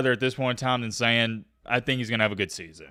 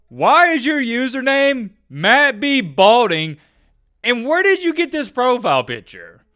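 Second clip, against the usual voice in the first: mocking high voice; 10 semitones higher, 7 dB louder than usual. Mocking a commenter's username.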